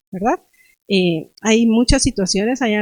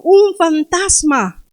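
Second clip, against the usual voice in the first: raised, drawn-out cry of alarm; cry of fear; 6 semitones higher, 4 dB louder, much slower than usual. Acted. Preacher quoting the disciples who see a figure on the sea, frightened cry. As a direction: call it out in acted fright. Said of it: "dieron voces de miedo".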